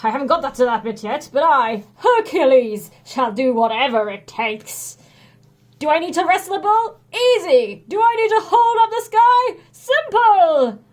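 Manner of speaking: loud bragging Hercules; boastful hero. A narrator's reading. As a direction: swagger like a proud strongman. Hercules voiced in story.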